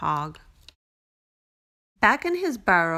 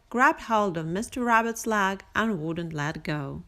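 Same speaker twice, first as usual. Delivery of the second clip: plain reading voice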